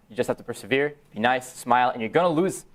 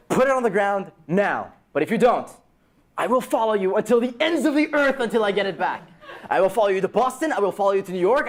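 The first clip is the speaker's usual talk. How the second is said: shouted ultimatum; 10 semitones higher than usual. Retold or imitated. Ultimatum to the thief.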